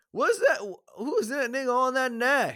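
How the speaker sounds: high, hammed-up indignant questioning; put-on high character voice; movie character impression. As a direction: pitch it way up in mock disbelief. Acting out a film character's line.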